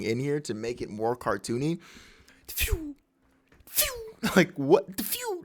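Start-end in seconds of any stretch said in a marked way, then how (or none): 2.5-4.0 high, soft whistling zip; cartoon sound effect. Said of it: "I love the sound effects". 5.0-5.4 very high, soft whizz; tiny whistled cartoon zip; cartoon sound effect. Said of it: "That's a crazy sound effect".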